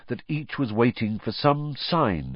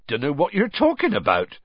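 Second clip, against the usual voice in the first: higher, breezily evasive denial; airy; 9 semitones higher, 3 dB louder than usual. A narrator's reading. Hagrid dodging Ron's teasing, airily. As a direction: brush it off airily.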